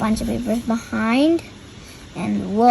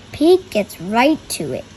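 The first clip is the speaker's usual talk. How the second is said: ordinary delivery, nothing marked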